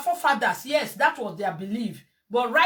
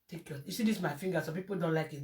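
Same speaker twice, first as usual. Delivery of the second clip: ordinary delivery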